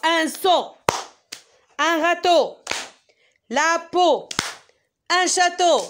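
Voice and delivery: Un seau, un râteau: each word struck hard on the clap; tapping out strongly. Shown as a demonstration. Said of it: "je vais le faire moi-même". (chaque mot frappé fort, plus haut et plus fort)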